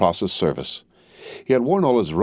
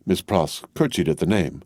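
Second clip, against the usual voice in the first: ordinary delivery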